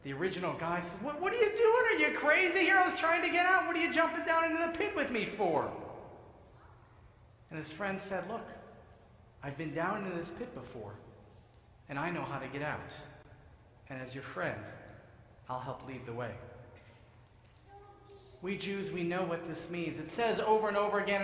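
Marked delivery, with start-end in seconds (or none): 1.0-5.7 man in pit, stunned as friend jumps in; astonished outburst. Acted. Man startled friend jumped down. 8.3-16.4 reassuring friend. Acted. Friend reassures man in pit.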